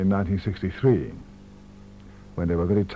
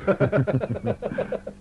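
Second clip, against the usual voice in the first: light chuckle of real amusement; amused laugh; 11 semitones higher than usual. Real, not acted. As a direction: laugh at the absurd story. Laughs at the sit-in story.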